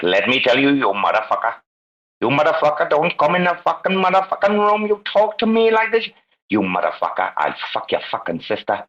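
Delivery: brutal Indian rant, climbing high, then dropping; Kumar. Retold or imitated. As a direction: imitate an angry Indian man laying into the room. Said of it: "man, he was brutal".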